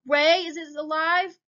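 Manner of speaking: high, loud, drawn-out call. A narrator's reading. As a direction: call anxiously to someone outside. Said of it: "she calls out".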